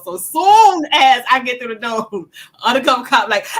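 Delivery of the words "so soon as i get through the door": ordinary talk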